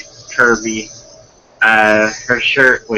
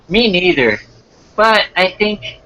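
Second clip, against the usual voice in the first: put-on higher-pitched girl character; put-on girly date voice; girl's voice; 8 semitones higher than usual. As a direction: raise the pitch to play the girl. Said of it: "then I'll do the girl's voice".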